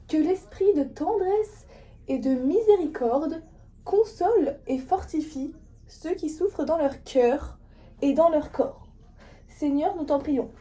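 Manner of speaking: slow, solemn prayer read; a careful, deliberate intercession read aloud; church reading. Shown as a demonstration. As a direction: recite it like a reader at Mass. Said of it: "je dis un texte".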